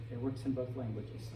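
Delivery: normal talking voice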